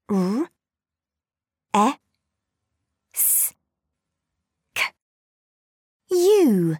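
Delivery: R, E, S, K, U as separate sounds; sounding out words. Shown as a demonstration. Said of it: "Blend the sounds".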